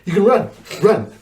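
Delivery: high, strained little command; mock J-hat. J-hat on tippy toes, high strained order. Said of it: "He was like".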